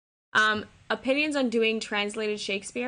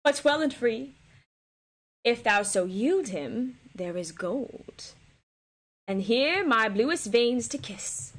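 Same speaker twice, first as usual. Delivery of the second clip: slow dramatic reading; slower than usual. Acted. Reading Cleopatra's lines.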